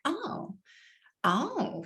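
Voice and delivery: surprised oh. Retold or imitated. Hairdresser reacting to being alone.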